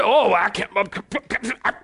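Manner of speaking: sputtering, scandalized churchgoer; religious folk. Retold or imitated. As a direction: stammer and choke with mock pious outrage. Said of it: "that's how they talk".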